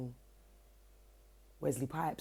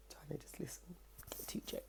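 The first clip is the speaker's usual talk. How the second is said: whisper; 13 dB quieter than usual. Retold or imitated. Eavesdropping on the argument.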